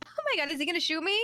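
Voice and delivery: high, quick frightened question; mock alarm. Player facing a drawn gun, mock frightened.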